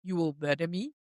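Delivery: lightly attempted Russian accent, rising question. Recapping a favourite line from the show, in a tentative Russian accent. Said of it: "like, Russian accent".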